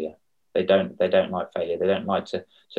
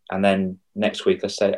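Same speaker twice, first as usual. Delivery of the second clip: ordinary talk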